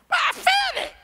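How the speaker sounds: very high squeaky protest; high mocking squeal; whiny fool. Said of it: "'Cause you crazy".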